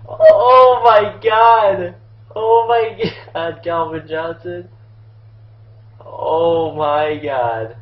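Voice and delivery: loud, slow, stretched-out amazement; awestruck outburst. Real, not acted. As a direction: draw each word out in stunned disbelief. Amazed by the catch.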